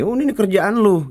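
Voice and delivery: high-pitched mimicked retort; mock blame-shifting. Mimicking someone passing the job back to the other, mock accusing.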